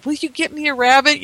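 put-on a little girl nagging her grandfather for a rabbit, pleading; child's pleading. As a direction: beg like a seven-year-old. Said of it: "I just kept saying".

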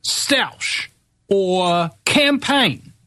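high, slow, exasperated ranting; fed-up tirade about campaign slogans; worked-up rant. Real, not acted. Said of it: "fuck it, I'm not gonna be calm".

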